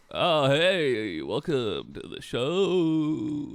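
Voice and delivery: slow, drawn-out announcer voice; fighting game announcer. Shown as a demonstration. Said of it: "That's my announcer voice".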